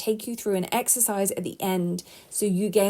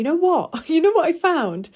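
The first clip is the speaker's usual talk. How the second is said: excited laugh; 8 semitones higher, 5 dB louder than usual. Real, not acted.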